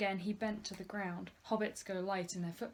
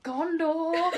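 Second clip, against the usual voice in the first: higher and louder joking cry; laughing shout; 9 semitones higher, 12 dB louder than usual. Acted. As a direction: yell it as a joke. Running joke, shouted laughing.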